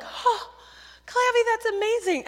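high, loud burst of awe; excited kids. Retold or imitated. Campers cheering Clavy's calling, excited kids. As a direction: imitate kids gasping and cheering in amazement. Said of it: "Kids don't care. They're like".

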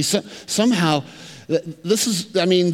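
usual speaking voice

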